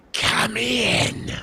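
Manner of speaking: high, loud creepy creature voice; scary voice. Acted. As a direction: make it chilling and eerie. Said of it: "That voice was so scary".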